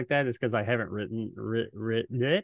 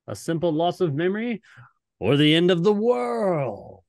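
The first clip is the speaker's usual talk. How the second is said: hammy ominous narrator; dramatic narrator redo; 7 semitones higher, 5 dB louder than usual. Pretend. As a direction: give it the full dramatic treatment. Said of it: "No, you gotta do it right".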